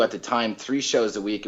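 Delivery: ordinary delivery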